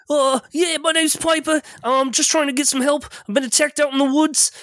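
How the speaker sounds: squeaky scared stranger at the door; high Piper voice. Acted. GM voicing Piper, asking the house for help, in a high scared voice.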